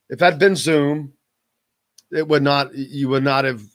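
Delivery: normal talking voice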